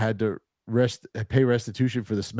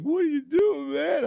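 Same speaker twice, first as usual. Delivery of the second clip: much higher, whiny wailing plea; mock crying plea; 3 dB louder, slower than usual. Drunk friend wailing at the owner, mock crying plea. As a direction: whine it like a drunk about to cry. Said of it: "He just started crying".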